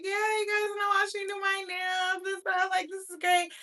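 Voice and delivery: high sweet fake-excited voice; high girly streamer voice. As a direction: gush high like a cheery streamer. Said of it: "I was screaming that".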